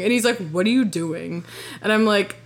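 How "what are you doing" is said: understated mock anger, unamused; retold pissed-off. Imitating ex confronting her as she cries, restrained anger. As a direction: imitate a mad ex, held in. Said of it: "he looks so fucking mad".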